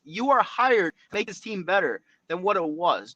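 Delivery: ordinary talk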